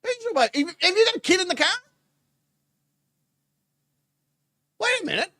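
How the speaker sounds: high-pitched, baffled questioning; incredulous. Real, not acted. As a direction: squeak it out in comic disbelief. Reacts in disbelief to baby remark.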